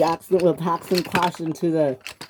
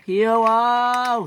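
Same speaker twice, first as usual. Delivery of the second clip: high, loud, stretched call; shout; 7 semitones higher, 5 dB louder than usual. A narrator's reading. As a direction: yell the name in protest. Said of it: "shouted James".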